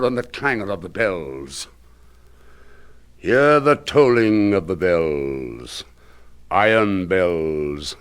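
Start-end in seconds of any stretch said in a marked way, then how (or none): 3.2-8.0 slow, low and solemn, like a funeral bell; slow tolling. Acted. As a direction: toll each word out slowly. Solemn tolling of iron bells.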